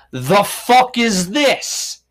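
slow, loud, disgusted drawl; disgusted outrage. Retold or imitated. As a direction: stretch it out, appalled. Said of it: "the right side said".

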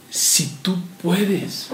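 hushed, slow, doubtful si tú puedes; drawn out and skeptical, barely voiced; doubting. Preacher voicing the father doubting Jesus, hushed and slow.

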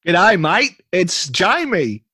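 hammed-up Aussie accent, calling out; broad Australian, stretched out and hammy. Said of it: "on Australian accents".

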